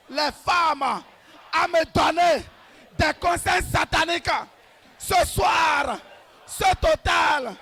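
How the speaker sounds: usual speaking voice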